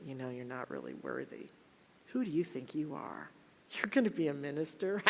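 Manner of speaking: a hint of a belittling inner critic; doubting inner voice. Her unhealed past voice mocks her calling, mildly sneering. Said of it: "plagued by that unhealed past voice that was saying".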